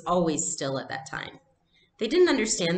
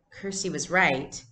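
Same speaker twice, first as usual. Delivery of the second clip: plain reading voice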